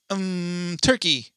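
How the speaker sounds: put-on innocent cherubic little girl; sweet small child saying turkey. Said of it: "like, in a little innocent, cherubic little girl voice".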